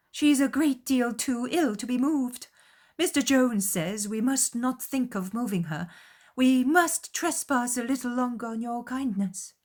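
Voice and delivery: high, pressing matron voice; fussy anxious mother. A narrator's reading. Mother insisting her sick daughter stay, high and fussy. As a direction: plead to stay, fussing.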